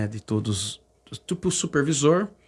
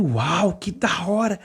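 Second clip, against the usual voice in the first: higher-pitched, awestruck delight; empolgação revivida; 4 semitones higher, slower than usual. Retold or imitated. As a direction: relive being thrilled by the crowd. Relembra espanto diante da multidão. (empolgado, imitando o próprio espanto)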